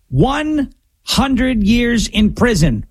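slow heavy emphasis. Real, not acted.

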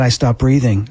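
normal talking voice

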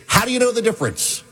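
a high, pressing professor's challenge; demanding professor. Retold or imitated. Professor grills the student on elephants, high and demanding. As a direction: push the student hard, voice pitched high. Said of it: "he was in full-on rage".

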